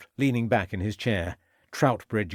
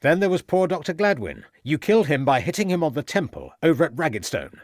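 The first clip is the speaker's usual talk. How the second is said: louder, higher accusation; raised voice; 5 semitones higher, 4 dB louder than usual. A narrator's reading. Detective pressing the farmer, louder accusation. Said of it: "raising his voice again".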